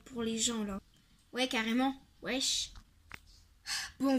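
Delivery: ordinary delivery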